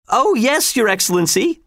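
high, eager agreement; crying out. A narrator's reading. Walter and Werner assuring the governor, high eager cry. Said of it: "they cried".

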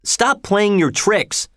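slow, high-pitched angry order; shouted. A narrator's reading. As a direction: raise the pitch in anger, stretching the words. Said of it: "he shouted".

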